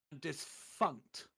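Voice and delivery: normal talking voice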